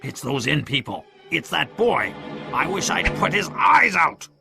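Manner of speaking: bitter outburst, rising to a high cry; Pew's angry cry. A narrator's reading. Blind pirate blaming the inn people, angry cry. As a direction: build from grumble to vengeful cry. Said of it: "Pew cried".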